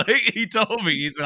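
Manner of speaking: can't help laughing as he says it. Real, not acted. Amused while retelling the dean's kindness, laughing.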